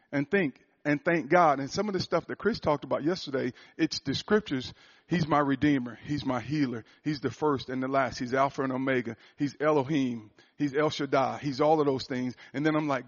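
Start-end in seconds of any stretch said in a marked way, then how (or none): none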